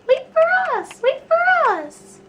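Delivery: put-on high, loud girlish call after friends. Lily calls after friends to wait, high and loud. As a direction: shout it high to make them wait.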